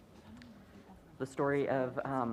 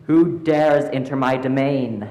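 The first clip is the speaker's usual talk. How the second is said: booming; 8 dB louder than usual. Acted. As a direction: boom it out like a winter hag.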